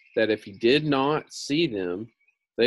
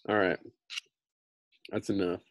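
ordinary delivery

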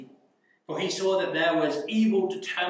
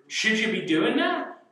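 raised-pitch leading question; mock scolding; 6 semitones higher, 3 dB louder than usual. Preacher grilling Haman over his behaviour, mock scolding. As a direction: ask it like telling off a child.

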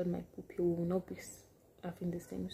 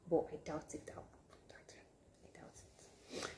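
skeptical near-whisper; whispered doubt; 3 semitones lower, 11 dB quieter than usual. Real, not acted. Doubting the friend's exaggeration, whispered aside. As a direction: hush it like a secret aside. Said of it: "doubting friend's story".

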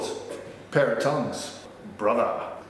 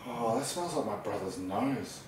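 quiet musing; 10 dB quieter than usual. Acted. Barry sniffing tongs in story.

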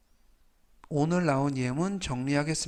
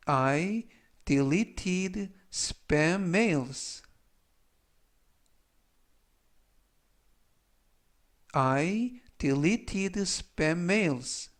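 very slow, word-by-word English model; slow, deliberate teaching read; language-lesson model reading; 3 semitones higher, much slower than usual. Shown as a demonstration. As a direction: read it out slowly for learners to repeat.

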